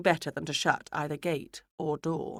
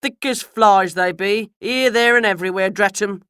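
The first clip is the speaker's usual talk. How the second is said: Mister Miles; 3 semitones higher, 9 dB louder than usual. A narrator's reading. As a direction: grumble loudly as a country carter. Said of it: "said Mister Miles".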